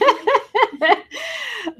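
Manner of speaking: a high, giddy laugh breaking out; high burst of laughter. Real, not acted. Laughing at herself for tearing up on a live sale, giddy high laugh. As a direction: laugh off the tears. After the handbasket joke.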